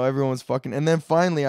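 ordinary talk

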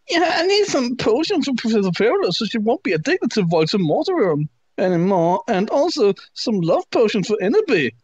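high whiny voice; whiny teen voice. Acted. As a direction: whine it like a spoiled teen. Draco quote read high.

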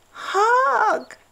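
very high loud cheer; a happy cub cheering for a hug. A narrator's reading. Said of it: "cheered Little Badger".

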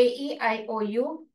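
ordinary talk

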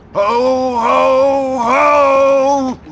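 belly-laugh it out like a mall Santa; jolly Santa laugh. Acted. Fake Santa laughing for photos.

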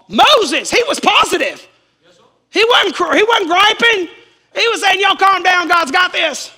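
high, loud, driving preacher cry; preacher shouting that God has this; full-force preaching. Acted. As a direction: lift the church with a shout about Moses.

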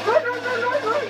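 high, urgent repeated run; yelling to flee the witch; panicked run call. Real, not acted. Player yelling at teammate to flee, high panicked call.

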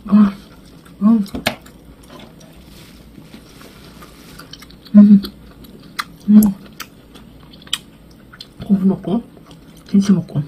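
murmuring while chewing food; humming with food in mouth; mouth full, chewing. Real, not acted. Seen 0: Cheeks puffed and chewing; kimchi strips pushed into mouth.

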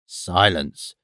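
low-pitched, restrained command; stern order. A narrator's reading. Father ordering his daughter quiet, low stern command. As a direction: command it low, without shouting. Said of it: "ordered his daughter".

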